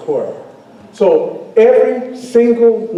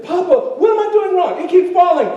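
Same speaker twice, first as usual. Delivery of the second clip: pleading student; 12 semitones higher than usual. Retold or imitated. Young self pleading with teacher.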